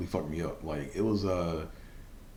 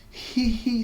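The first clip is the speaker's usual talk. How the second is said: very high falsetto "hee hee"; 4 dB louder than usual. Retold or imitated. Likening his botched laugh to a singer's squeal, high falsetto. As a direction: mimic a pop star's squealed hee-hee. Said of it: "on some [name]".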